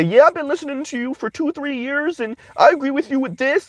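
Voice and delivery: hammed-up high voice of an agreeing caller; imitating female callers. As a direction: parody the eager women phoning in. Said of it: "women are calling from across the planet".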